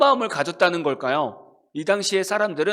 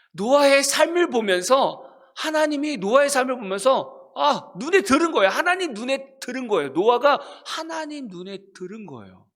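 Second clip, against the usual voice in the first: higher and louder, driving the point home; emphatic preaching; 5 semitones higher, 4 dB louder than usual. Real, not acted. Preacher stressing to the congregation that Noah found favor, louder. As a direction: build fervor, hitting each repetition.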